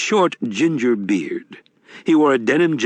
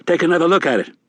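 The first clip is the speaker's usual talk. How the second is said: a touch higher, genial con man; sly old grifter, a bit higher; lightly voiced Wednesday; 7 semitones higher, 3 dB louder, faster than usual. A narrator's reading.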